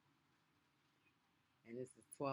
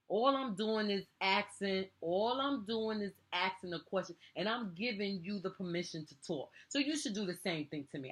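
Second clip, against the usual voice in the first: real exasperation, voice raised and higher; getting loud; 5 semitones higher, 4 dB louder than usual. Real, not acted. Parent demanding respect from a child, getting loud. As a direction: insist on your point with rising volume and pitch. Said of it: "when I get all out of line and get all loud".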